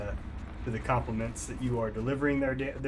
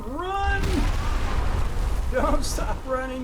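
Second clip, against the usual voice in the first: breathless fright; 12 semitones higher, 5 dB louder than usual. Real, not acted. Fleeing danger in game, panicked.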